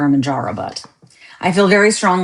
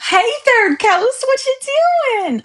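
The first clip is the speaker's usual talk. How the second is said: cheery call-out; 4 dB louder than usual. Real, not acted. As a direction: stretch it out, calling across the room. Calling out to a viewer.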